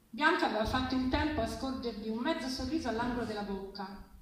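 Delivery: plain reading voice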